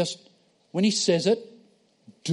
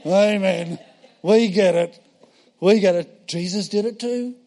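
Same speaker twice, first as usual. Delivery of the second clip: mocking mums chorus; 5 dB louder than usual. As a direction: roll your eyes like bored mums saying amen. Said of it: "All the mums said".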